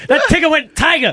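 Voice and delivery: high, laughing through the words. Retold or imitated. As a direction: crack up on the line. Repeating caller's line laughing.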